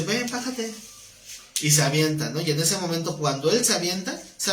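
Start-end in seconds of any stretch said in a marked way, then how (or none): 0.0-0.7 higher, softer invitation from the Lord; gentle Lord's call. Retold or imitated. The Lord inviting Peter to step onto the water, gentle. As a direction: coax him gently off the boat.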